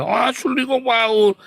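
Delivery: very high, loud mock protest; protesting pastor. Pastor insisting to counselor he is genuine, high protest.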